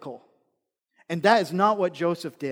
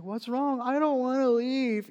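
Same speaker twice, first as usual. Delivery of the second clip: higher, drawn-out mock whine; imitated bawling child; 6 semitones higher, slower than usual. Child protesting to mom at pickup, mock bawling. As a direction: lightly mimic a tearful child's protest. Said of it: "he's just bawling".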